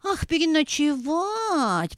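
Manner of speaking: slow drawn-out dawning ah; a slow coy recovery; coming round. A narrator's reading. Hen regains her composure at the guest's request, slow and drawn out. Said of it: "приходя в себя".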